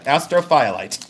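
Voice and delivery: "Astrophyllite" as AS-tro-FY-lite; "Astrophyllite" split as astro plus FY-lite; spelt-out pronunciation. Retold or imitated. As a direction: say "Astrophyllite" with a long FY, as a joke. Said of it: "Okay, I got it".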